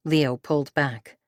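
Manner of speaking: ordinary delivery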